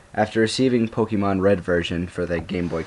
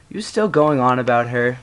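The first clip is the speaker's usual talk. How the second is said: higher-pitched, exasperated question; mildly fed up with hearing about it; 5 semitones higher, 3 dB louder than usual. A narrator's reading. Boy fed up with friend's talk of a girl, exasperated. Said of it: "James asked in exasperation".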